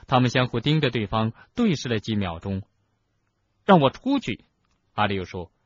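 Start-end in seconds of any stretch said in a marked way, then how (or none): 3.7-4.4 high, loud order to be let out; loud demand. A narrator's reading. Harry demands release from the office, high and loud. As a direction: demand it forcefully, pitched high.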